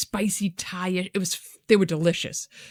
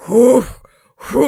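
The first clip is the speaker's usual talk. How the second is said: room spinning whoo; 4 semitones higher, 10 dB louder than usual. Retold or imitated.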